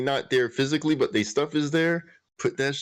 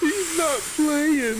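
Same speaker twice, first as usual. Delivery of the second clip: mock awe; much slower than usual. Reacting to stream, put-on voice.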